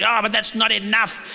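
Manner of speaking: mock grumbling; lightly put-on dissatisfied moan; complaining. Citizens dismissing the drop in crime, mock complaining. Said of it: "We love to complain".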